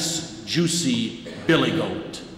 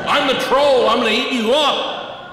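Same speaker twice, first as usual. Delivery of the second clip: higher, much louder mock troll bellow; troll shout; 9 semitones higher, 4 dB louder than usual. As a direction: bellow like a big bad troll. Troll shouts at big goat.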